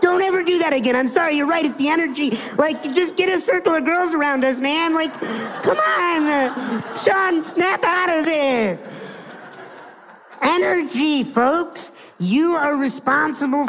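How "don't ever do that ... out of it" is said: high-pitched, pleading panic; escalating mock desperation, climbing higher; freaking out. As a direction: act out a frantic friend begging. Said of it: "he's, like, freaking out".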